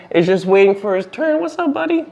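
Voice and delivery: high cooing greeting to a pet. Real, not acted. Greeting his snake Kevin.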